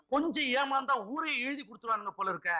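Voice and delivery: ordinary delivery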